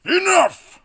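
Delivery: very high, loud godly roar; terrifying booming God. Acted. God interrupts the mutiny parade, booming. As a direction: boom it down from the sky. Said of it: "a terrifying voice booms from the sky".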